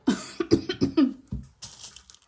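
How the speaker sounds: harsh loud cough; coughing fit. Real, not acted. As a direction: cough hard and sudden. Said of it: "my coughing and spluttering".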